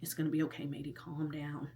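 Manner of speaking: quiet, soft talking herself down; calming herself down under her breath; self-soothing. Retold or imitated. Irritated patient quietly calming herself at the dentist. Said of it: "I was really irritated and was like".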